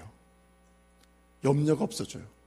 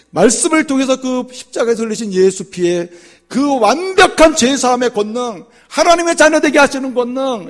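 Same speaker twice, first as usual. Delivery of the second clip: high, loud preaching; preaching at full force; 11 semitones higher, 14 dB louder than usual. Acted. Pastor challenging the congregation, loud preaching. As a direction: press the question on the church.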